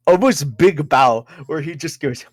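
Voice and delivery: ordinary talk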